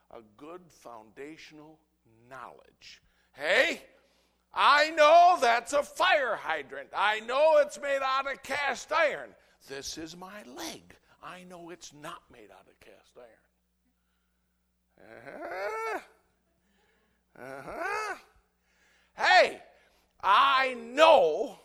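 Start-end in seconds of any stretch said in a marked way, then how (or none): 3.3-13.3 high, loud put-on voice; mock a smug know-it-all. Preacher imitating a proud know-it-all. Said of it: "acted know-it-all voice". 15.0-18.2 high, put-on uh-huh grunt; mock uh-huh. Preacher voicing a smug agreeing grunt. 19.2-21.6 high, loud call; shouted declaration. Real, not acted. Preacher shouting to the congregation.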